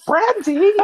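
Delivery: mock shocked yelp. [name] calls out after slap.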